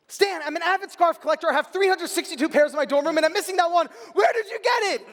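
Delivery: loud, high, earnest pleading; over-earnest, high-pitched outburst; mock-serious urgency. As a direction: build to a shouted demand, as if deadly serious. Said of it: "with all the seriousness I can".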